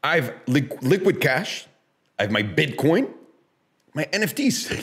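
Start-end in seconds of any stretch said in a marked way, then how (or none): none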